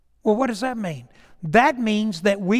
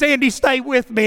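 mock panic; 7 semitones higher, 7 dB louder than usual. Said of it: "he says".

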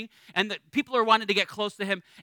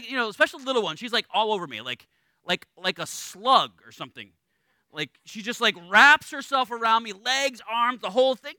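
ordinary talk

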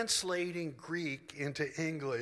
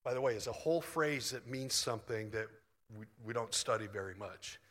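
normal talking voice

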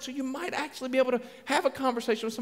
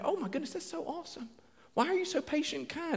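high, soft mock gushing; softly cooed mock admiration; gushing admirer; 5 semitones higher, 10 dB quieter than usual. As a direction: imitate an impressed friend, hushed and high-pitched. Acts out admirers of stay-at-home mom.

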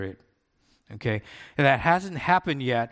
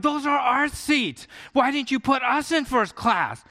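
upset; 9 semitones higher than usual. Retold or imitated. Rude couple whines at the gate agent, mock upset. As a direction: complain like a passenger who feels cheated. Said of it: "would get so upset".

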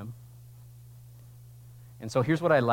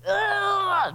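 groan; 4 dB louder than usual. Pretend. Picturing creation groaning like a tree.

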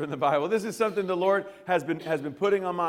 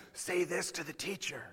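much softer, hushed urging; sneaky classmate; 11 dB quieter than usual. Retold or imitated. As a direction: egg him on under your breath. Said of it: "He whacked me on the arm".

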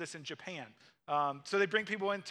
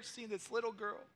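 normal talking voice

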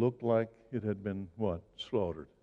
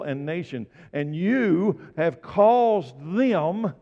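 full-force preaching; 4 semitones higher, 6 dB louder than usual. Real, not acted. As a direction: proclaim it forcefully, stretching key words.

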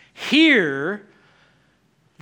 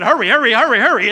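loud, rapid-fire hurrying; acted urgency; 6 dB louder, much faster than usual. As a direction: urge the crew along in a panic. Voicing panicked disciples fleeing.

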